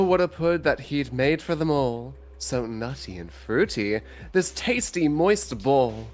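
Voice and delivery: ordinary talk, nothing marked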